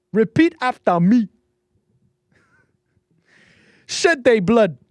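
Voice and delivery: put-on shouted, high-pitched African-accented rallying cry; mock African accent. Host acting out a film leader, shouted mock African accent. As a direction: lead a chant loudly in a put-on African accent. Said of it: "can do an accent".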